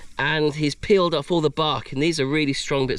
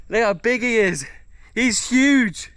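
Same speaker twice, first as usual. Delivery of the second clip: raised pitch, climbing into amazement; amazed excitement; 6 semitones higher, slower than usual. Real, not acted. Showing off the huge crab, amazed. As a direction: exclaim it in real awe at the size.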